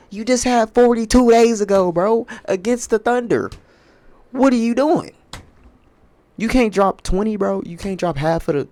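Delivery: high, loud pleading rant; exasperated scolding. Real, not acted. Scolding LeBron over lost bet.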